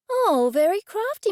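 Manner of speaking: high, loud teasing jab. A narrator's reading. Teasing Mister Buttons about wine.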